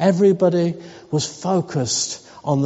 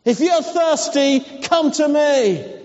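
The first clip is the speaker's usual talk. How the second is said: calling out; 8 semitones higher than usual. Acted. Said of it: "he shouted".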